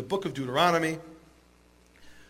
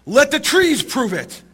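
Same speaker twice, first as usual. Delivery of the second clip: loud, raised pitch, drawn-out challenge; acted shout; 5 semitones higher, 7 dB louder, slower than usual. Rabbi challenging his doubting colleagues, shouting out. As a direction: call it out like a proclamation. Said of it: "Rabbi Eliezer shouts out".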